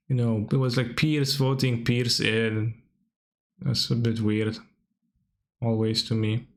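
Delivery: normal talking voice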